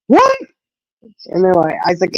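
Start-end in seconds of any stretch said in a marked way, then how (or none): 0.1-0.4 high squeaky shocked what; retelling her own shocked reply; startled what. Retold or imitated. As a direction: jump at the news, voice shooting up. Hearing about the snake.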